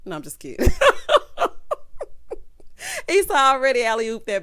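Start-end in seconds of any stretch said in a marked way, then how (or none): 0.8-3.0 high airless laugh; breathless wheeze laugh. Real, not acted. Laughing at own joke.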